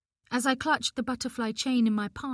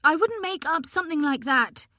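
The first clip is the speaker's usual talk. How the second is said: higher and louder, defensive; rising, louder insistence; 7 semitones higher, 6 dB louder than usual. A narrator's reading. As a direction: let the pitch climb as she defends herself. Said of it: "My voice rose in pitch".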